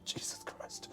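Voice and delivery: whispered in dismay; soft unvoiced groan of words; exasperated whisper. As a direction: breathe it out like a despairing viewer. Viewer reacting to car crash.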